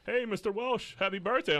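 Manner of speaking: high, loud pharmacy-caller imitation; chirpy caller. Pharmacy calling with birthday wishes, mock-chirpy. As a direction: put on a sing-out phone greeting.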